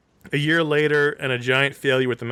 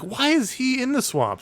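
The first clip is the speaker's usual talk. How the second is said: high-pitched baffled question; puzzled disbelief; 11 semitones higher than usual. Retold or imitated. Viewer recalling his first reaction to the plot, puzzled disbelief. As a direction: question it as if it makes no sense.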